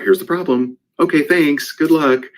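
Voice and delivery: mock brush-off. Mock dismissive coach brush-off.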